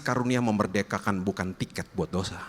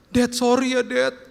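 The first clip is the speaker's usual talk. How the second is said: high, a little louder mock tearful apology; a hint of a teary teenage apology, much higher; imitating a half-cry; 5 dB louder, slower than usual. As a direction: play the son's tearful sorry, lightly. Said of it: "Sambil setengah nangis dia peluk saya".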